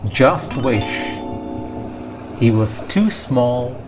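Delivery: usual speaking voice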